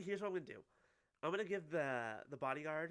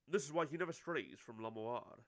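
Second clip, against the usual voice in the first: lower, firmer character voice; manager character; 5 semitones lower than usual. Acted. Manager explains the pianist's closeness, lightly voiced low and firm.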